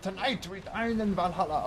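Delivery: high, booming heroic declaration; hammed-up Spartan battle cry; epic war cry. Shown as a demonstration. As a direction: roar it like a warrior charging into battle. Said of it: "That's surprisingly tame".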